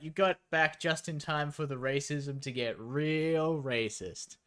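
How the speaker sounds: normal talking voice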